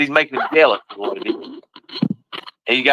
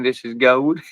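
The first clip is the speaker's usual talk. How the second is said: ordinary delivery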